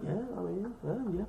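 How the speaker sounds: half-convinced mumble. Retold or imitated. Brother being talked into it, soft unsure yeah. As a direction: trail off, half believing her. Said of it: "You're like".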